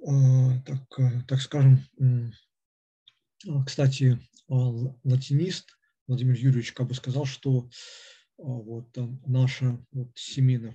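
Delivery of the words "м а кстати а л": usual speaking voice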